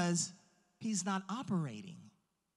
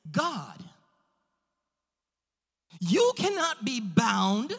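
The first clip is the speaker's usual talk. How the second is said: forceful pulpit encouragement; emphatic preaching; 4 semitones higher, 5 dB louder than usual. Real, not acted. Preacher encouraging the congregation about a limitless God, emphatic preaching. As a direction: preach it with drawn-out key words and big pauses.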